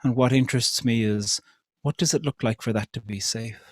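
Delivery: ordinary delivery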